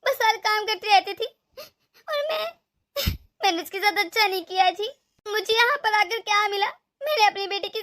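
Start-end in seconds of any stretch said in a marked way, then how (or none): 2.1-2.5 voice breaking. Acted. Mother-in-law breaks down in remorse.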